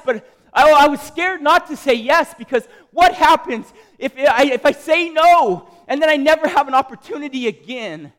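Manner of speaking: high, loud, urgent outpouring; real impassioned yelling. Real, not acted. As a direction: pour it out at full volume. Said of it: "I'm yelling".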